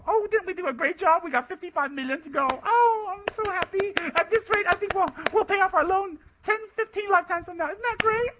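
very high, loud squeal of fake glee; mock delight. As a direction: gush like overjoyed fundraisers, hammed-up. Mocks self-congratulating fundraisers.

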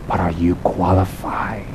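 very low, soft and slow; hushed aside. Acted. Teacher quietly questions boastful lama-seekers, hushed and slow.

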